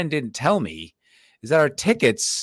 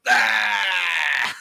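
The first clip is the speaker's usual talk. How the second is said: defiant yell; 7 semitones higher than usual. Retold or imitated. Flipping off the whole section.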